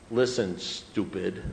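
slow, deliberate put-down; blunt condescension. Retold or imitated. Sponsor finally gives him the answer, blunt condescension. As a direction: spell it out slowly for a dimwit.

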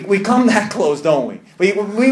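ordinary talk

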